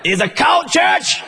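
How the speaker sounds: high, slow sneering jab; mocking devil. Devil's final attack on the church, high slow mockery. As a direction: drag it out high to sting. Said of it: "Finally, he says".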